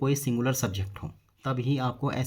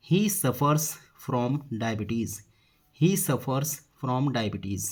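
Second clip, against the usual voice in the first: slow, word by word; measured dictation-style delivery; slow model sentence; much slower than usual. Shown as a demonstration. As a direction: say it slowly for learners, spacing each word. Teacher models the English sentence.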